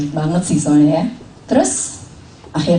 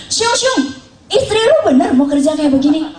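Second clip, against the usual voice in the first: high, laughing imitation of an old man; doubtful father-in-law; 9 semitones higher than usual. Father-in-law asking his son about his wife's job, high and laughing. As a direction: tease out a worried old father.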